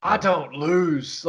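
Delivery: slow, stretched Tennessee twang; bad Tennessee accent. Mocking an actor's movie line, bad Tennessee accent. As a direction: drawl it as a badly faked Tennessee accent. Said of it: "trying to do a Tennessee accent".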